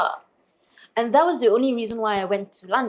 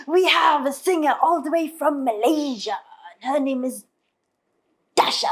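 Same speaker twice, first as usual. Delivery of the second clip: mock stage announcer; 5 semitones higher than usual. Host introducing a singer from Malaysia, grand announcement. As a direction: imitate a host announcing the next act.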